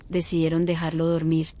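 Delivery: usual speaking voice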